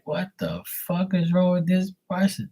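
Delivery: high, softened incredulous aside; retold disbelief. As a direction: act out baffled disbelief, pitch up. Retelling his reaction to gushing guys.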